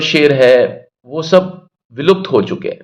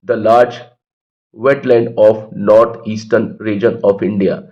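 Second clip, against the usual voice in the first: slow dictation; 4 semitones lower, much slower than usual. Shown as a demonstration. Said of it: "teacher dictating the line".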